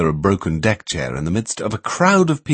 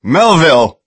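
shouting; 8 semitones higher, 9 dB louder than usual. A narrator's reading. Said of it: "banged the table again and shouted".

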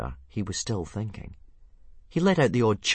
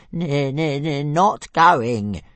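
high stuttering dragon, digging in; slow squeaky defiant stutter; Toothless stubborn; 8 semitones higher, 10 dB louder, much slower than usual. Acted. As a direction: stammer a flat refusal. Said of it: "said Toothless stubbornly".

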